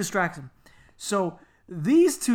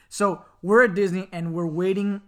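ordinary talk, nothing marked

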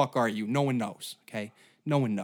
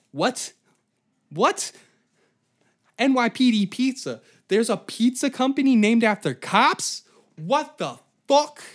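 much higher, louder disbelief; incredulous; 9 semitones higher, 5 dB louder than usual. Real, not acted. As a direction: react in total disbelief. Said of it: "incredulous reaction".

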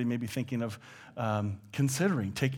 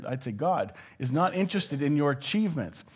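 ordinary talk, nothing marked

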